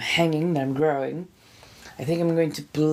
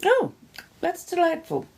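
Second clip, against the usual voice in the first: delighted. Real, not acted. Reacting happily to chat.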